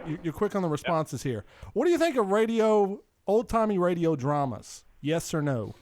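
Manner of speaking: ordinary talk, nothing marked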